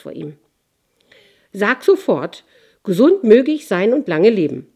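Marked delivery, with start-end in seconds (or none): 1.5-4.7 loud commanding king; snapping at him. Acted. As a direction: bark the order. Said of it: "fuhr ihn der König an".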